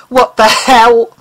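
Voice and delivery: usual speaking voice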